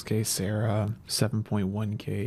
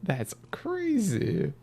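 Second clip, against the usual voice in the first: drawn-out, high disbelief; slow disbelief; 10 semitones higher, much slower than usual. Real, not acted. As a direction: let it sink in slowly. Disbelief at drop.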